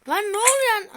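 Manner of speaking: sudden startled yell; sudden shout. Real, not acted. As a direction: yell out in surprise. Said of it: "Size bağırmadım".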